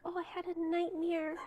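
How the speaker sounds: high, soft mock-innocent voice; feigned helplessness. Retelling her ploy at Lucien's room, feigned helplessness. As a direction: imitate a put-on poor-me plea, small and high.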